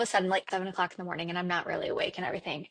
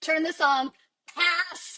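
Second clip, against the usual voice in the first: higher, imitated child giving an order; little girl's voice; 6 semitones higher, slower than usual. Daughter in the back seat rejecting the radio song, little voice. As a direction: put on a small child's high little voice. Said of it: "I hear her little voice from the back".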